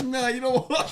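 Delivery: laughing quote. Retold or imitated. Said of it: "he's like".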